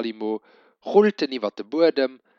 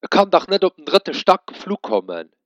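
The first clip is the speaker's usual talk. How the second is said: higher, louder exasperated father; cursing father; 7 semitones higher, 6 dB louder than usual. A narrator's reading. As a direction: throw up your hands in disbelief. Flucht mei Pap.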